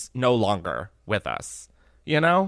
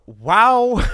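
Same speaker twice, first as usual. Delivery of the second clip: stunned wow; 7 semitones higher, 7 dB louder than usual. Real, not acted. Reacting to shocking tooth story.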